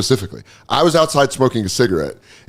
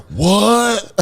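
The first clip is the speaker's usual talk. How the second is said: mock surprise; 8 semitones higher than usual. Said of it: "And we were like".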